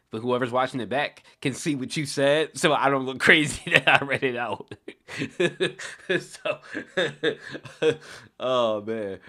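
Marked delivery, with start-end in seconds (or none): 2.6-4.7 higher, louder, laughing through the words; real amused laughter while talking; cracking up. Real, not acted. Cracks up at a chat comment. 4.8-6.2 high, loud burst of laughter; helpless high laugh; real amused laugh. Real, not acted. Laughs at a chat comment. 6.6-8.4 high, ongoing laughter; lingering high laugh; real amused laugh. Real, not acted. Keeps laughing at the comment.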